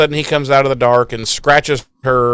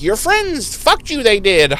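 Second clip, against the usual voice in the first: high, slow gloating demon voice; taunting Gnarl; 10 semitones higher than usual. Retold or imitated. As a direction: sneer it like a smug little monster. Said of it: "Gnarl's like".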